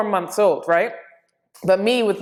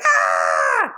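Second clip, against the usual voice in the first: drawn-out mock scream; imitated scream. Jumping into the deep end, mock scream. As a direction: act out screaming mid-jump. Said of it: "I jumped and I screamed".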